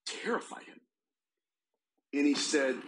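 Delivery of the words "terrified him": hushed whisper. Retold or imitated. Dramatic hush in retold story.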